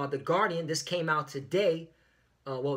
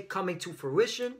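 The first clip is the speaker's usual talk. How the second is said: usual speaking voice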